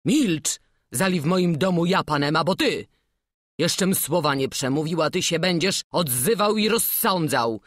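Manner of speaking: put-on an old master silencing his journeyman; stern master. Master rebukes journeyman angrily.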